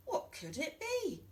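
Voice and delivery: high, wondering group voice; curious animals. Acted. Farm animals wondering at the egg, high and curious. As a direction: ask it wide-eyed as the farm animals.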